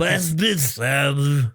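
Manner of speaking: slow, garbled joke voice; comically slurred, drawn-out mumble; speech therapy voice. Pretend. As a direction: mangle the words slowly for laughs. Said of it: "That should be your speech therapy voice".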